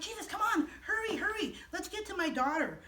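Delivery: high, hurried plea to come quickly; a worried father urging hurry, hurry; father urges Jesus to hurry home, high and impatient; impatient pleading. Acted. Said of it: "Jairus was getting a little impatient".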